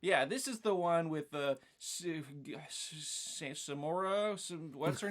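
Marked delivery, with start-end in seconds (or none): none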